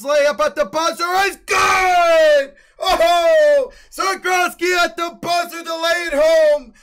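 huge high shout, the first line stretched out slow; buzzer-beater scream. Acted. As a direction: scream it like the crowd just exploded.

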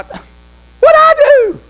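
very high, loud mock squawk; indignant protest. Preacher defending himself to the old ladies, indignant squawk. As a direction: imitate an outraged, wrongly accused man.

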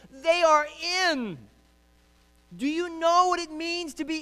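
high, loud, impassioned preaching; full-force preaching. Real, not acted.

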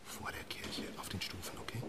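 hushed fatherly whisper. Acted. Father whispering to his daughter in church. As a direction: whisper softly at the funeral.

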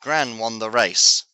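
ordinary delivery

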